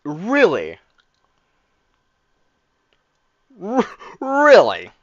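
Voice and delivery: loud, high, drawn-out really; stunned, stretch the word out in horror; shocked disbelief. Real, not acted. Streamer reacting to the dad's suicide line, shocked and drawn out.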